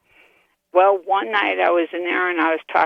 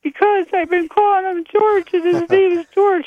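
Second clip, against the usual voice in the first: high-pitched mock-panicked protest; retold freaked-out yell; 12 semitones higher, 3 dB louder than usual. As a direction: squeal it like you're retelling your own fright. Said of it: "I yelled out a scream".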